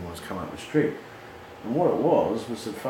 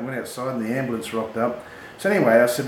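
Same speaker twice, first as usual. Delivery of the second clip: ordinary talk, nothing marked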